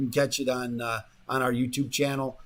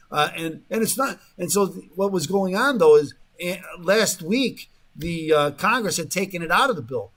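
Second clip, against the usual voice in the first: ordinary delivery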